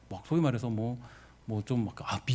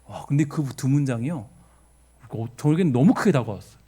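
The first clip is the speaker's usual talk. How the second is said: ordinary delivery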